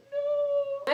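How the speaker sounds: very high, loud, drawn-out wail; hammed-up cry of horror; mock anguished scream. Viewer watching her fall in slow motion, mock anguished scream.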